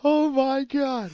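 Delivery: high drawn-out shocked squeal. Real, not acted. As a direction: squeal it out in disbelief. Reacting to steamy verse.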